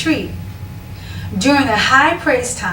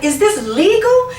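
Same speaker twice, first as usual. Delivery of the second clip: much higher playful disbelief; incredulous; 10 semitones higher, 3 dB louder than usual. Retold or imitated. Woman startled by her own power, much higher joking disbelief. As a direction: squeak it up high, comically unsure. People dropping when touched.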